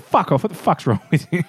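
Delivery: mock-aggressive retort with a laugh; half-laughing mock anger; mild mock anger. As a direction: tell him off with a laugh. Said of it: "It was a very aggressive".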